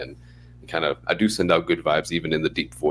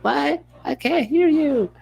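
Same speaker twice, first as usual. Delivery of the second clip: much higher, slower puzzled caller; confused caller; slower than usual. Retold or imitated. Patient on the phone who can't hear, in a much higher put-on voice. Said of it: "They're like".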